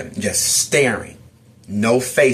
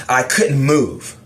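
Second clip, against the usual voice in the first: normal talking voice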